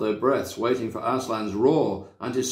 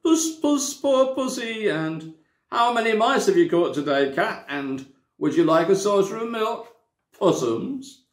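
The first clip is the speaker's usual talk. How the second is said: high, mocking sing-song taunts; jeering taunts; 8 semitones higher than usual. A narrator's reading. Creatures jeering at Aslan, high and mocking. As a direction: sneer at the cat like a jeering mob. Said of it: "jeering at him".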